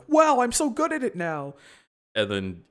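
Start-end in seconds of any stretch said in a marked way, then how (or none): 0.1-1.5 high, louder gushing Katara; high mock Katara. Katara after one waterbending tip, high and gushing. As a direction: gush it high and smug. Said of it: "And then Katara's like".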